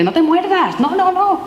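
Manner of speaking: high coaxing no-no-no; gently scolding a child. Acted. (regañina suave y aguda a una niña)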